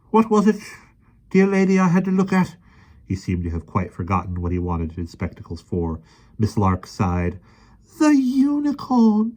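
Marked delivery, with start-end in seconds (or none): 0.1-2.6 put-on high, dithering old man; absent-minded and polite; vague old professor. A narrator's reading. 8.0-9.3 high, slow and patient; patiently. A narrator's reading. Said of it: "she answered patiently".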